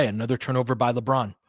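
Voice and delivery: ordinary talk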